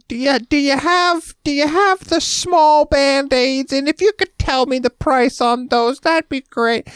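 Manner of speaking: very high, slow, drawn-out dopey voice; a customer phoning the store, voiced very high and slow; clueless caller impression. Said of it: "And they're like".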